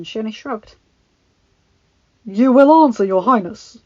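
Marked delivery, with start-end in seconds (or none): 2.2-3.8 loud angry demand. Acted. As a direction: snap it at her. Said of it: "Charles said angrily".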